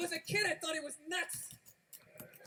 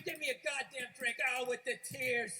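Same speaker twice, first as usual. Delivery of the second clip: normal talking voice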